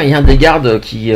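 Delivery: usual speaking voice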